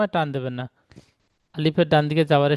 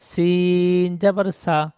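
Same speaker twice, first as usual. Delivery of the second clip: সিন জবর সা spelled out very slowly; slow spelling-out; much slower than usual. Shown as a demonstration. Teacher spells সিন জবর সা slowly for the pupil. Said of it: "কেটে কেটে বানান করলে".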